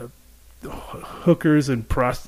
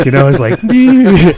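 laughing; 6 semitones higher, 9 dB louder than usual. Real, not acted. Laughing at Barney Rubble neighbor.

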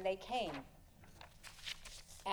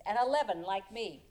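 ordinary delivery

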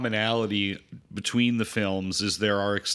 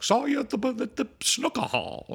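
lightly voiced stranger, much higher pitch; high mock stranger; 13 semitones higher than usual. Podcaster imitating a stranger greeting the hero, high and mock-cheery. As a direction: chirp it like a cheery acquaintance. People accosting the doppelganger's victim.

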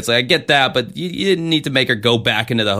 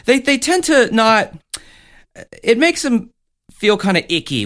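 mocking impression; 12 semitones higher than usual. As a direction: parrot him in a squeaky mocking voice. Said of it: "You're, you're like".

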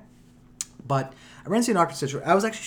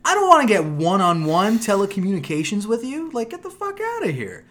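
exasperated; 6 semitones higher, 5 dB louder than usual. Real, not acted. Exasperated rant.

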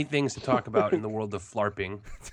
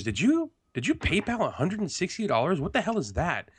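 higher, sharp imitation of his wife; accusing wife; 9 semitones higher than usual. Husband mimics his wife catching his PayPal spending, raised accusing pitch. Wife's accusing question retold.

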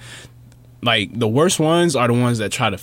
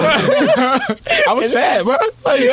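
words squeezed out through laughter; laughing too hard to get it out; much slower than usual. Real, not acted. Laughing while admitting he was sad.

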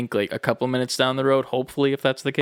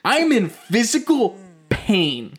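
1 indignant rant; 4 semitones higher, slower than usual. Retold or imitated. Ranting at dad.

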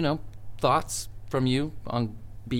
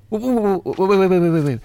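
loud rapid-fire stream of waits; urgent interrupting; 7 semitones higher, 7 dB louder than usual. Acted. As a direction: cut in urgently, high and loud. Urgently stops the caller.

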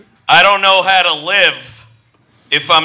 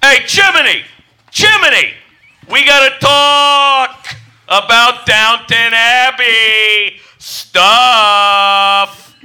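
calling out; 5 semitones higher, slower than usual. Acted. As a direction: shout after him down the hall. Butler calls after chimney sweep.

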